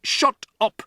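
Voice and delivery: loud angry shout; father shouting. Acted. Father shouting Matilda down, loud and angry. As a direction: bark her down. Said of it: "the father shouted".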